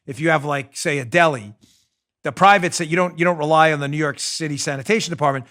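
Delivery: ordinary talk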